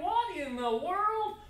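high, slow, baffled "What in the world?"; amazed thought. Retold or imitated. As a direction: stretch it out in disbelief. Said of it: "I'm thinking".

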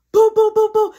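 loud, high rapid "boo boo boo"; string of loud thudding sounds; imitated banging. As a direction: hammer out the noise of banging.

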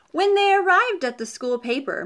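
usual speaking voice

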